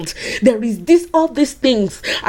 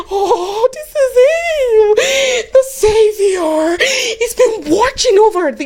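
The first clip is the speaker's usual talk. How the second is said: high, tearful cry of wonder; high, slow, tearful joy; overjoyed Anna; 12 semitones higher, 3 dB louder than usual. Acted. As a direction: gasp it out like an old woman seeing her Saviour. Anna sees baby Jesus.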